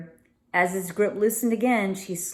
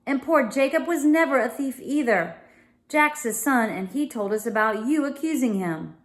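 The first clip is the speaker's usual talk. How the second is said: furious accusation; 6 semitones higher than usual. A narrator's reading. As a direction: round on the liar with fury. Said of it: "eyes gleaming furiously".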